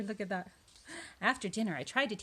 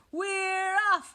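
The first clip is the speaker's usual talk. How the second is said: high, loud send-off cheer; crew shouting; 12 semitones higher, 8 dB louder than usual. A narrator's reading. Crew answers the captain on departure, shouting. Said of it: "we all shouted".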